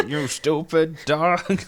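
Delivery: slow, high, loud mock insult; Eustace. Eustace insulting his dog Courage, slow loud mock scolding. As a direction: drag it out as an irate cartoon owner. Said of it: "and Eustace going".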